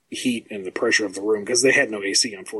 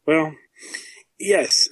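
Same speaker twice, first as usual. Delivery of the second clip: usual speaking voice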